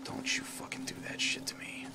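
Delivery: very soft, voiceless whisper; whispered warning. Real, not acted. Player warns the game not to do that, whispering. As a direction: whisper it under the breath. Tense moment in a scary game.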